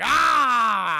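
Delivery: long, loud, drawn-out cry; imitated swell. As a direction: belt out a dramatic "ah". Dramatic swell after love declaration.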